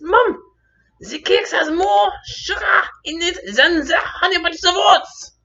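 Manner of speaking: put-on high, loud, drawn-out kid protest; hammed-up shrieking tantrum, slowed for emphasis; child's tantrum. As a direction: wail the argument like an outraged child. Acts child's outburst at mother.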